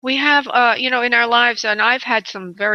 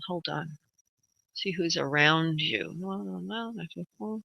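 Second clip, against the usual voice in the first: murmuring to herself, much softer; softly murmured; 3 semitones lower, 10 dB quieter than usual. Real, not acted. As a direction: drift into a soft concentrating murmur. Medium tuning into caller's energy.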